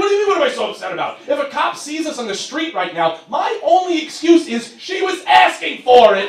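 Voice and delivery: high, loud, frantic rant; exasperated rant. Retold or imitated. Boyfriend panicking at his happy girlfriend, loud high rant. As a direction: fire it back in a panicked outburst. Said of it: "I'm like".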